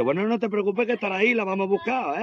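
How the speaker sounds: a hint of a soothing mate; voiced workmate.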